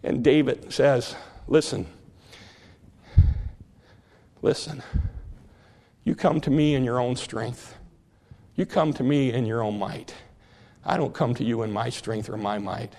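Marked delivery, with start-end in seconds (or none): 1.5-12.9 low, soft, unshakable faith; lightly voiced confident shepherd boy, low and soft; calm steady resolve. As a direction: answer the giant quietly and firmly. David answers Goliath calmly.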